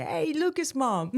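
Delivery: a lightly voiced teacher saying hi; teacher greeting. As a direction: call out brightly like a passing teacher. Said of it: "he said".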